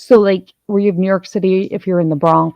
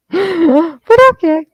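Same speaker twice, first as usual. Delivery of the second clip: much higher, slow, through laughter; laughing; 10 semitones higher, 5 dB louder, much slower than usual. Real, not acted. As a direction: squeeze it out mid-laugh. Laughing after the tease.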